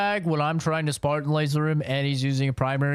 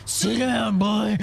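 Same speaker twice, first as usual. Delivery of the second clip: stern father shout; 9 semitones higher, 5 dB louder, slower than usual. Acted. Stern daddy role.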